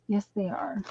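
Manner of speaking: breathy whisper, no voice at all. Real, not acted. To herself after reading a card, in a whisper. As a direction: whisper it to yourself, private and soft.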